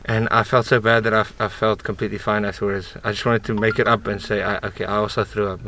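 muffled behind surgical mask; speaking with jaw wrapped; through a face mask. Real, not acted. Seen 5: Blue surgical mask covers mouth and nose throughout; jaw wrap too.

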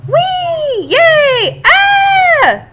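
high loud shrieks of joy; gleeful squeals. Acted. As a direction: squeal like giddy kids on a slide. Eggs squealing on their escape.

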